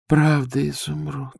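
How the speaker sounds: soft, hushed and spellbound; enchanted. A narrator's reading. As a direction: breathe it out in wonder. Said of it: "сказала она зачарованно".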